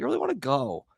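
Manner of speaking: high pleading whine, imitating his teen self; mock whiny plea. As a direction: beg to go like a sulky teenager, mock. Retold fight with girlfriend.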